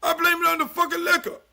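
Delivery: high, loud, strained outcry; loud comic blame. Real, not acted. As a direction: shout the blame onto the liquor. Confessing wild past.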